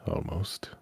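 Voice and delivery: breathy whispered aside. Real, not acted. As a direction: whisper it as a sly afterthought. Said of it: "whispered afterthought".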